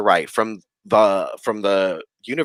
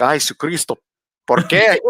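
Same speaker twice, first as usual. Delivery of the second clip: scared Spanish exclamation; 6 semitones higher than usual. Retold or imitated. Scared alone in dark room.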